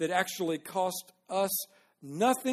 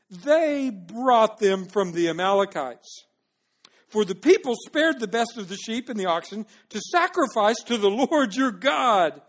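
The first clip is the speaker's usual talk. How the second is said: blame-shifting; 3 semitones higher, 7 dB louder than usual. Acted. Saul shifting blame, read dramatically.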